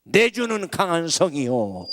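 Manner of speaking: loud, ringing proclamation of the hymn title; hymn line proclaimed. Shown as a demonstration. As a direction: declare it like a battle cry. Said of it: "그때 부른 찬송이".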